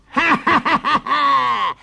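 long, high shrieking cackle; evil witch cackle. Acted. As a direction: cackle in wicked triumph. Witch gloats over trapped children. (risata malvagia da strega)

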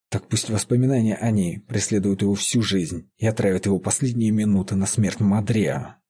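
ordinary delivery, nothing marked

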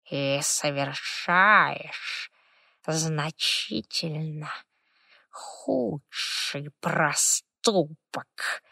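very slow, soft and creaky; a stern old spirit, each word dragged. Acted. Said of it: "Дымовой дух был суров". (суровый скрипучий голос духа)

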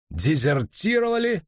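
disbelieving. A narrator's reading. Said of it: "недоверчиво повторил Боннард".

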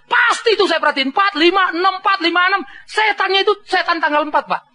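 high, sure-of-himself explaining; knowing husband. Retold or imitated. Husband telling pastor his theory, high and sure. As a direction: pitch it up like a man who cracked the code.

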